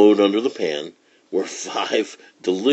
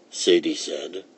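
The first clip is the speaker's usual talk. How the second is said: usual speaking voice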